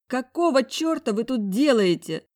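half-sob it out in shock; sobbing protest. Acted. Heroine caught undressed, tearful high cry. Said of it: "всхлипнула я".

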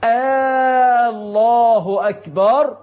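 "الله" drawn out with a long questioning aa at the start; همزة استفهام ممدودة. Shown as a demonstration. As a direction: say "آه الله أكبر" as "aallaahu akbar", slowly. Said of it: "فيقول هكذا". (نطق خاطئ بمدّ همزة "الله" في أولها كالاستفهام)

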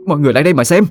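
lightly hammed crone wailing for the neighbours, pitch lifting at the end; old woman throws a tantrum, calling "xem" with a high rise; mock wailing old woman. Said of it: "giãy lên nành đạch mà ăn vạ".

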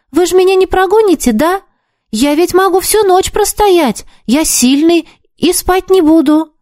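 put-on a boy begging to stay; eager boy voice. A narrator's reading.

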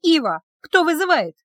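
high-pitched answer to a call; urgent call answer. A narrator's reading. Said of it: "ещё по пути к столу произнесла". (торопливо, высоким голосом)

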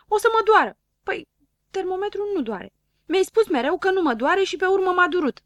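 put-on high, loud complaining little boy; whiny Dunno. Dunno whining to doctor Pilulă that it hurts, high and loud. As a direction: whine at the doctor who lied.

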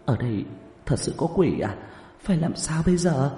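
anxious questions, the last one high; worried, uneasy young woman; tense questioning. A narrator's reading. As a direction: ask nervously, rising into alarm. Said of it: "căng thẳng hỏi".

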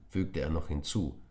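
usual speaking voice

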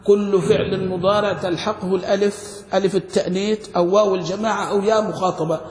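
usual speaking voice

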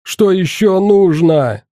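tearful plea. A narrator's reading. Doomed boy begging his captor, slow tearful question. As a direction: ask through tears, begging. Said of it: "Плача орал смертник".

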